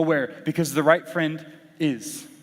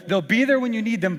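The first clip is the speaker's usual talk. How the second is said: usual speaking voice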